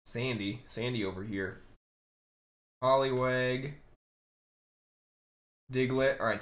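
ordinary talk, nothing marked

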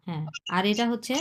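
ordinary talk

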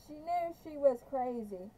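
ordinary delivery, nothing marked

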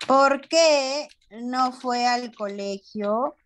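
slow, stretched question rising at the end; slow dictation. Shown as a demonstration. Teacher writes out a pupil's question, slow dictation. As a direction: dictate word by word at writing pace.

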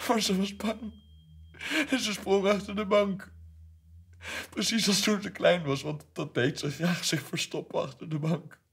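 high, loud, overwrought father losing his grip; frantic high pitch. Acted. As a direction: voice straining upward in mad tenderness. Overwrought climax. (hoog, luid en overspannen)

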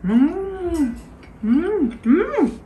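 humming while chewing; savoring a mouthful; chewing with mouth closed. Real, not acted. Seen 0: Bites meat at -3s, chews with closed mouth through hums.

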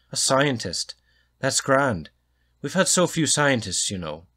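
booming Irish or Scots welcome to a scientist; hearty Scots or Irish accent. Acted. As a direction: boom it out in a thick Scots or Irish lilt. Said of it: "his accent is Scots or Irish, but it's heavy".

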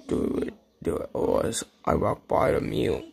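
unvoiced menacing whisper of a toy wrestler; playful whispered wrestler threat. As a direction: hiss the threat like a villain.

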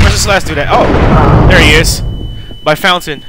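loud, high, urgent callout; excited shouting. Real, not acted. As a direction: yell it out mid-fight. Hunting the last enemy, shouting.

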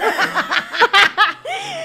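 loud, high burst of laughter. Real, not acted. Risa tras el grito. (risa aguda y fuerte)

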